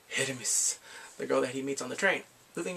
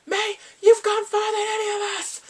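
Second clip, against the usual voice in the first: loud falsetto battle cry; over-the-top cheering; 8 dB louder than usual. Retold or imitated. Online friends spurring him to confess, hammed-up cheering. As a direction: shriek it high, rallying him.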